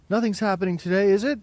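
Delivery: fast, loud, high sneering question; rally the gang against her; mocking taunt. A narrator's reading. Boy egging on the other children, fast and mocking.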